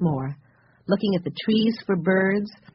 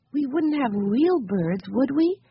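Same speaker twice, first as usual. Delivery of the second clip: higher, slow, mildly anxious; worried question; 7 semitones higher than usual. A narrator's reading. Violet asking Henry about real birds, mildly worried. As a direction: ask slowly and high, seeking reassurance. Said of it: "looking worried".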